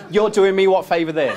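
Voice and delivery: mock the offer while laughing; high, loud, laughing retort; laughing sarcasm. Mocking the manager's free offer.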